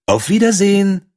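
a bright little bird waving farewell; swallow's farewell. Acted. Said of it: "sagte die Schwalbe".

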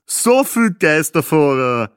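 slow, loud, drawn-out wisecrack; jokey husband hamming it up; joking. A narrator's reading. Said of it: "scherzte ihr Mann".

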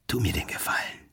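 hushed provocation, nose to nose; whisper. Acted. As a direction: whisper it right into his face. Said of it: "Und dann etwas leiser".

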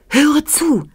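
high, eager little creature; little flea. A narrator's reading. Flea lets the child in on his plan, high and urgent. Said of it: "sagte er".

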